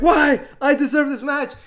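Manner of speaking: loud, high protest; indignant protest. Retold or imitated. Champion loudly protesting the refusal. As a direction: demand it like you're owed it.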